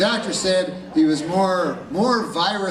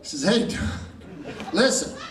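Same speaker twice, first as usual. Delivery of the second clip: telegraph guy; 5 semitones higher than usual. Acted. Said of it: "the telegraph guy says".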